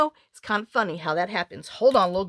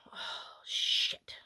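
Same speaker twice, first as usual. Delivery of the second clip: whispered curse; 12 dB quieter than usual. Real, not acted. Crafting mistake, muttered aside.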